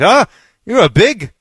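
put-on light Scottish-accented teasing; imitated Scottish ribbing. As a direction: size someone up like a Scottish pub joker. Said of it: "and he was like".

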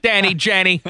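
hammed-up Boston holler of a name; drawn-out mock Boston cry; mock Boston accent. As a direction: call out like an exaggerated Bostonian. Joining the mock Boston bit.

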